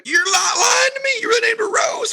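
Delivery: imitated high-pitched shout of disbelief; incredulous outburst. Man challenging parents on daughter's name, mock outraged shout.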